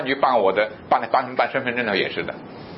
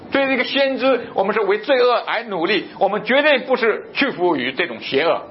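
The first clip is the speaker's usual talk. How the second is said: raised, ringing sermon voice, slower on key phrases; fiery preaching; 8 semitones higher than usual. Acted. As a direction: preach it from the pulpit with conviction. Preacher exhorts against evil.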